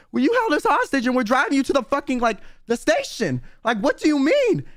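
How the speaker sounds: high-pitched mock outrage; indignant. Storyteller protesting while driving the passenger to the station, mock indignation.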